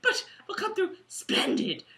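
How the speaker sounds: put-on high, fussing British accent; Chummy. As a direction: reassure in a plummy, halting British voice. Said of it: "might be my best accent show".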